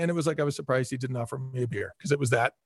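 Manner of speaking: normal talking voice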